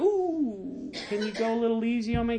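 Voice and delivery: high, soft "ooh" into a quick plea; mock pleading. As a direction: playfully beg for leniency, high and hurried. Acts out flinching at God's humility check.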